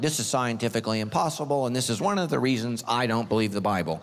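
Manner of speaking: mock the doubter in a low smug tone; a hint of a smug skeptic. Mocking a skeptic churchgoer.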